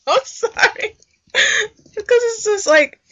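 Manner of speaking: high, giggling apology; laughing. Real, not acted. Listener cracks up at the sneezing scene, giggling high. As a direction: laugh through the words.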